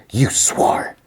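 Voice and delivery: retold accusing whisper; voiceless, hushed reproach. Retold bitter reproach to Joel.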